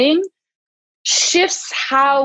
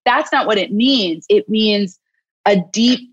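usual speaking voice

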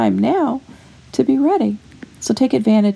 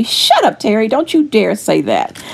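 sassy scolding Holy Spirit. Retold or imitated. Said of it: "My Holy Spirit tells me".

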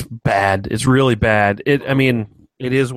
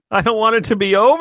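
high, louder plaintive whine; hammed-up whine; mock whine; 11 semitones higher, 6 dB louder, faster than usual. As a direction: wail it like a sulking child. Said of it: "whining about a show ending".

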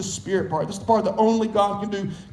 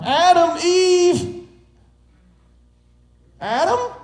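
calling out for Adam and Eve; 6 semitones higher than usual. Retold or imitated. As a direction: call out searching, then puzzled with a laugh. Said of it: "he called out".

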